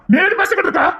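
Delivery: high furious yell; angry shout. Acted. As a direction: shout her down in rage.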